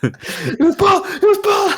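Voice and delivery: very high, strained comic wail; mock desperate cry. Blaming co-host while dragged away, mock desperate cry. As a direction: wail the accusation in play panic. Acts being hauled to jail.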